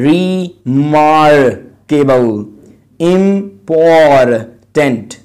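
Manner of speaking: each word said slowly, stressed syllable punched; teacher modelling word stress; stressed word list. Shown as a demonstration. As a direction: drill the stress in "remarkable" and "photography" word by word. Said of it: "जिन words पे stress दी जाएगी".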